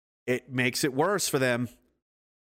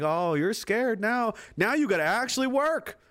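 higher, slow mocking taunt; 6 semitones higher than usual. Taunting the government, slow and gloating. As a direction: gloat at the scared opponent. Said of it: "I'm like".

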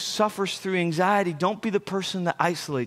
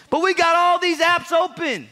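excited; 9 semitones higher, 4 dB louder than usual. Real, not acted. Laughs off his own joke.

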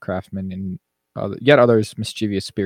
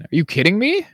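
incredulous; 10 semitones higher, 4 dB louder than usual. Real, not acted. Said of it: "exasperated at the audience".

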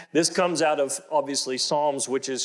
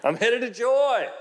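much higher, slow, laughing shout; laughing yell; 10 semitones higher, 3 dB louder than usual. Retold or imitated. Man yelling back at his sleepy wife, laughing. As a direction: call it out again with a laugh. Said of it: "I'm yelling from the bathroom".

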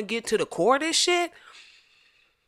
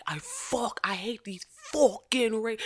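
rough imitation of a British accent; attempted British accent; 2 semitones higher, 5 dB quieter than usual. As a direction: try an angry British accent, badly. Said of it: "my accent really ain't about that".

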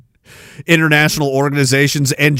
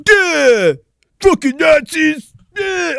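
high, dopey mock shout; mocking a shouter; 13 semitones higher, much slower than usual. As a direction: ham up a dim protester yelling. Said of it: "mocking the Antifa shouters".

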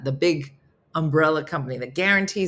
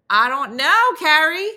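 clueless rep; 11 semitones higher, 7 dB louder than usual. Retold or imitated. Imitating the clueless phone rep.

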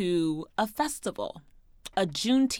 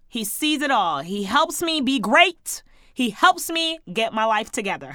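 ordinary talk

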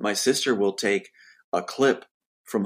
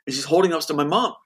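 ordinary talk, nothing marked

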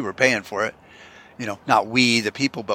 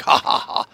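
mock anchor chuckle. Fake anchor laugh.

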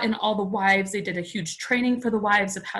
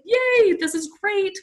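mock cheer; 12 semitones higher, 4 dB louder than usual. Retold first reaction to going home.

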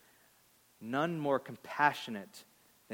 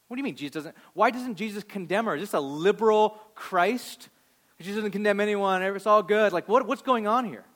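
higher, louder incredulous preaching; heated rhetorical challenge; 7 semitones higher, 8 dB louder than usual. Acted. Pastor voicing the congregation's doubt, with incredulous preaching heat. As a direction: throw the objection at the room. Preacher voices a sceptic's objection.

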